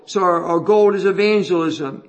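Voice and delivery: ordinary delivery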